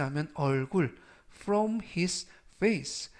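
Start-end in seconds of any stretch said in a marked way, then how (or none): 1.3-3.1 very slow English phrase, word by word; slow model reading. Shown as a demonstration. Teacher reading a phrase slowly for students. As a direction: draw it out slowly for learners.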